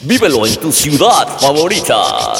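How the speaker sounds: slow, booming ad announcer. Acted. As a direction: hype it like a radio spot. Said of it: "déjame te hago el anuncio".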